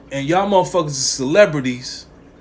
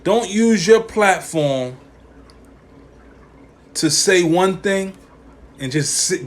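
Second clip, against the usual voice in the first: ordinary talk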